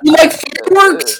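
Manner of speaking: high, loud shocked squeal; outraged disbelief. Real, not acted. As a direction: screech it like you've been betrayed. Shocked reaction to co-host liking fireworks.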